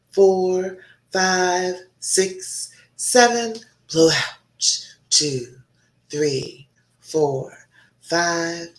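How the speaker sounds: guided breathing count. Acted. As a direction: slowly coach the viewers through each breath. Leads a breathing exercise.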